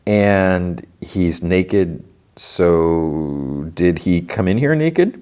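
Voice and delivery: very slow, drawn-out questioning; retold puzzlement. Asking shopkeeper about the naked body, slow puzzlement. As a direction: stretch "And" and "so" while working it out.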